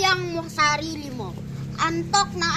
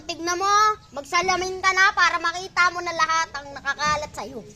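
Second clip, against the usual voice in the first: normal talking voice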